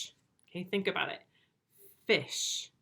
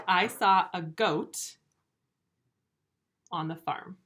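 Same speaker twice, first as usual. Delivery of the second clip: slow and a bit louder, lingering on saw; slow example sentence; 5 dB louder, slower than usual. Shown as a demonstration. Teacher gives pupils a slow, clear example sentence. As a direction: spell out the meaning, slow and clear. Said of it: "Write the word goat".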